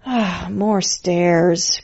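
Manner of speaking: slow, soft tired grumble; weary grumble about the stairs; grumbling. A narrator's reading. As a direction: complain under the breath, dragging it out. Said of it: "grumbled Zero".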